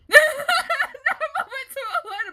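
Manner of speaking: laughing hard while turning down the move. Real, not acted. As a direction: break into a high laugh on the repeat.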